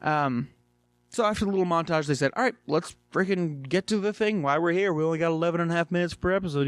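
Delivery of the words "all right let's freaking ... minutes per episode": higher, rushed and eager, racing through the last line; pushing to get on with it, fast and keyed up; hurried mock urging. Greg telling Steven to hurry, rushed and eager.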